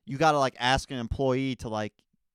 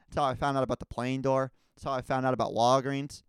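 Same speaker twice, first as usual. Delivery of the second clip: ordinary talk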